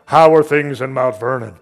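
booming voice. Retold or imitated. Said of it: "I hear this booming voice".

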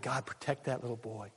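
soft, low-pitched plea to God; retold quiet prayer. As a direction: recall a hushed, earnest prayer. Retells his prayer for boy.

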